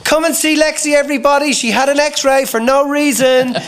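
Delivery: put-on high sing-song child voice announcing to everyone; mocking little sister. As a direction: crow it out like a gleeful little sister.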